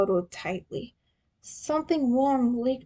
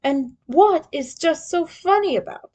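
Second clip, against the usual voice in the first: mild angry demand, louder; angry alien; 6 semitones higher, 5 dB louder than usual. Acted. Krang angrily demanding why Leo laughs. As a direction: snap at the laughing turtle. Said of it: "angrily spoke".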